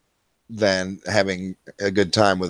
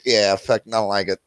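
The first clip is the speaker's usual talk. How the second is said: normal talking voice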